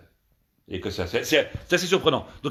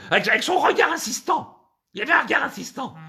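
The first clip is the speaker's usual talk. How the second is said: high, put-on female voice; accusing woman; 9 semitones higher than usual. Woman describing the stare to officer, accusing complaint. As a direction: complain in indignant falsetto.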